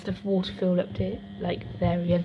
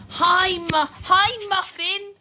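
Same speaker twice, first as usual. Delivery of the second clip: mocking classmate; 12 semitones higher, much slower than usual. Retelling how a classmate taunts her, high mocking voice. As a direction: imitate his taunting sing-song hello. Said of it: "And he says to me".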